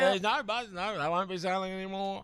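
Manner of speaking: high mock commentary, loud at first; mimicked holler, easing in volume; imitated Australian bystander. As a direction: call it out like the Aussie onlooker.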